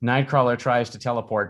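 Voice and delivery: usual speaking voice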